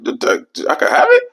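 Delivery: very high squeaky disbelieving friend; hammed-up high thrilled friend; delighted friend. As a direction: squeal like a kid getting candy. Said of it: "He like".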